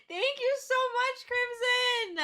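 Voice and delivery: high, squealed gratitude; real teary squeal; tearful thanks. Real, not acted. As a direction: thank them as if overwhelmed to tears. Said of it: "I gotta wipe my tears".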